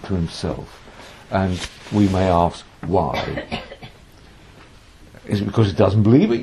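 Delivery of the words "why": normal talking voice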